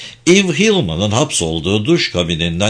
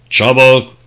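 louder, one sharp urgent order; shouted command; 7 dB louder than usual. Acted. Dick hurries the Tommyknockers with a loud shouted order. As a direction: hurry them on with a shout.

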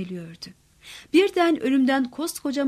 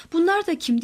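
higher-pitched, narrated alarmed question; startled cry; 6 semitones higher than usual. A narrator's reading. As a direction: cry out in alarm, kept short of a scream. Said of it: "diye bir çığlık attım". (hafif bir çığlıkla, yüksek perdeden)

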